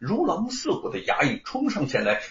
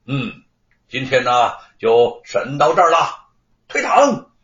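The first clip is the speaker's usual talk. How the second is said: magistrate pronouncing judgment; 6 dB louder than usual. Acted. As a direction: proclaim the verdict and dismiss the court. Magistrate proclaims the verdict. (官老爷高声宣判退堂)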